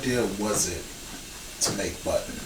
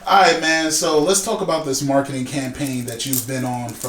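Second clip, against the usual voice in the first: loud, higher-pitched show kickoff; energetic host restart; 6 semitones higher, 6 dB louder than usual. Real, not acted. Host to guest, back from break, loud and upbeat. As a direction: launch back in with raised voice.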